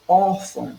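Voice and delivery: "Órfão" with a high, loud ÓR; stress on ÓR. Shown as a demonstration. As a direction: punch the first syllable of "Órfão". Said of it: "também na penúltima sílaba".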